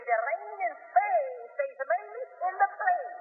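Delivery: slow, mock-proper elocution drill; slow, overly careful English with stretched vowels. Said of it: "they'll work on that poor native".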